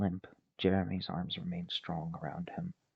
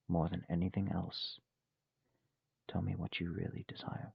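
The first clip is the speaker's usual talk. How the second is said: normal talking voice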